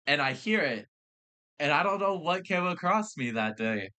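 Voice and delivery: usual speaking voice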